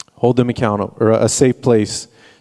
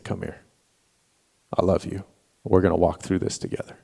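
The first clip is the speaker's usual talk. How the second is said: tender dad; 3 semitones lower, 7 dB quieter, faster than usual. Retold or imitated. Dad comforting weeping son.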